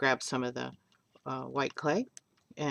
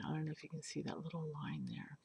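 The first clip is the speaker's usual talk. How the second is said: whispering; 15 dB quieter, faster than usual. Real, not acted. Whispering to the camera close up.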